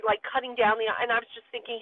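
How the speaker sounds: ordinary talk, nothing marked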